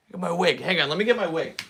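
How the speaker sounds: usual speaking voice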